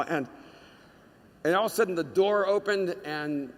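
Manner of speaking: normal talking voice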